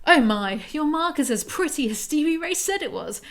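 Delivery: high gushing mum voice, a little hammed-up; gushing mother. Reader voicing Stevie Ray's mum gushing over Zoe's mark. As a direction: gush over the girl's mark.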